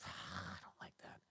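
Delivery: slow, whispered pout; knife thrower sulking at the rules, whispered. Acted. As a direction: breathe it out sulkily. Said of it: "he goes, sighs".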